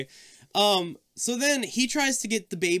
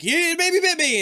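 high pleading whine; 7 dB louder than usual. Retold or imitated. Son insists to parents.

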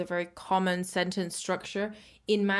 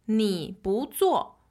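slow model reading; slower than usual. Shown as a demonstration. Teacher models the sentence slowly.

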